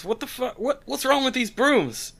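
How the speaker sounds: high, baffled Southern outburst; a Southern thug staring at his burning broom; Southern accent. Acted.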